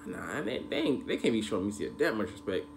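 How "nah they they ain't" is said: ordinary talk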